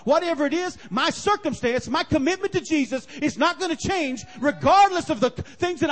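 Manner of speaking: loud, high, driving sermon cadence; full-force preaching. Acted. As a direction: preach it hard, building the fire.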